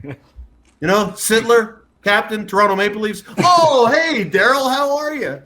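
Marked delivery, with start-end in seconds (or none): 3.4-5.4 much higher, shouted excited hello; thrilled greeting. Retold or imitated. Squid greeting Daryl on the phone, a much higher, shouted excited hello. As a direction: burst out delighted to hear him.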